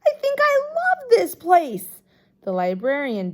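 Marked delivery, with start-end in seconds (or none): 0.1-1.9 very high, loud gushing; thrilled childish declaration; excited. A narrator's reading. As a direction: swoon over the library out loud. Excited kid in the library.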